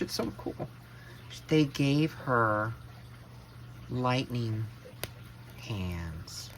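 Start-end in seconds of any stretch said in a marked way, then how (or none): 0.0-0.7 whisper. Real, not acted. Whispered awe at the lightning hands. 5.6-6.5 whisper. Real, not acted. Whispered awe at the lightning hands.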